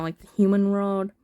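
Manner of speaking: ordinary delivery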